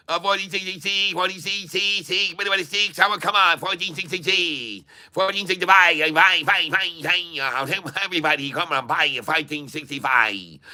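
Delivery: high mock Chinese auction chant; Chinese auctioneer voice. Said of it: "do the auction voice in Chinese".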